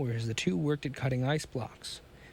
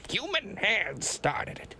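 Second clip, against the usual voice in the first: slow, higher, trembling fury held back; helpless rage; 8 semitones higher, slower than usual. A narrator's reading. Scientist raging at the unseen saboteur, slow held fury. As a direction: bite each word out slowly. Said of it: "quivered with helpless rage".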